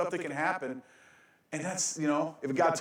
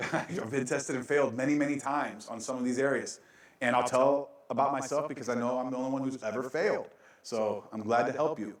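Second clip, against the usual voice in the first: normal talking voice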